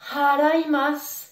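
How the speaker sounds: haraimasu with a strong breathed H you feel on the hand; aspirated H. Shown as a demonstration. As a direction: exhale on the H of haraimasu. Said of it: "tu sens bien le souffle". (haraimasu, H soufflé sur la main)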